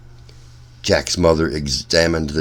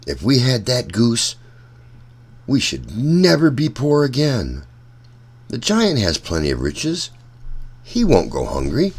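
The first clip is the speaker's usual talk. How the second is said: put-on higher, eager young Jack; a hint of a hopeful boy scheming; lightly voiced boy; 6 semitones higher than usual. Jack plotting to take the goose, lightly higher and eager.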